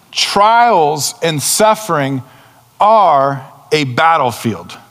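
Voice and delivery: very slow, each word weighted; emphatic point. Real, not acted. Preacher driving home his point, very slowly. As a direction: spell out the key line slowly. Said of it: "slow emphatic key point".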